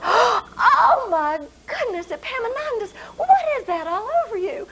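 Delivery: high, shocked gasp of dismay; a mother aghast at the mess. Acted. Mother shocked at buttered son.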